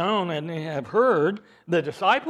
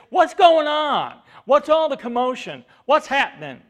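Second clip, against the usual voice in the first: blind man calling out; 7 semitones higher, 9 dB louder than usual. Retold or imitated. Blind Bartimaeus loudly asks the crowd what's happening. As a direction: call out to a crowd you can't see. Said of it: "but he asked them".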